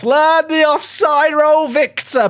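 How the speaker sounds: high, loud, drawn-out scolding; exasperated shout. Real, not acted. Streamer scolding his offside striker, exasperated shout. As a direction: holler it at the offside player.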